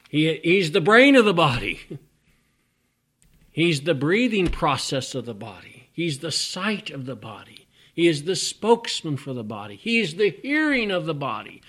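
loud, high preaching voice. Real, not acted. Preacher builds to full force.

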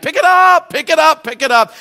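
high, loud cry easing down each time; urging shout, fading. Real, not acted. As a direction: push them to act, then ease off. Repeated exhortation, fading.

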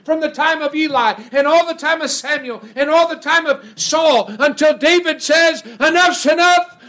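rising revival preacher climbing to a shout; fiery preaching. Acted. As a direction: preach it up to a roar.

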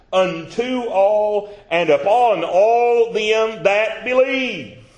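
high, loud, slow scripture reading; preacher reading Romans at full force; preaching scripture. Real, not acted. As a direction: proclaim the verse slowly.

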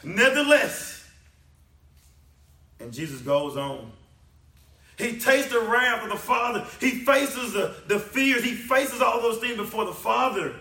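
high, loud sermon climax; impassioned preacher in full cry; full-force preaching. Real, not acted. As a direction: preach it loud, pitched well up.

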